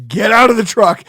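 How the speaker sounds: loud, angry shout, retold; retold angry yell. Driver retelling ordering friends out in traffic, angry yell. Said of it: "I was so angry".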